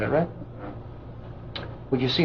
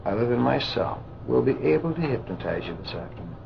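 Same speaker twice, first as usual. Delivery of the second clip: hypnotic induction; 4 dB quieter than usual. Real, not acted. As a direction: lull the subject down gently. Hypnotist leads trance induction.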